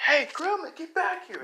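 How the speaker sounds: high-pitched heroic shout; calling out. Acted. Deku chasing the Gremlin, calling out high. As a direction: yell after someone getting away. Hero calls after fleeing villain.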